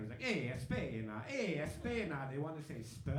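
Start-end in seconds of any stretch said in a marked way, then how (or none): 0.2-2.3 put-on drawn-out Spanish-accented call; slow, mock Spanish cheer; Spanish greeting. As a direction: hail a fellow Spaniard, stretching the Spanish vowels. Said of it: "if it's Spanish people, they do the same".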